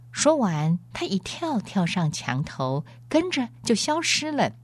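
usual speaking voice

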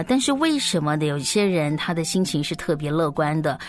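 usual speaking voice

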